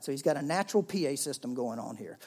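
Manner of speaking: ordinary talk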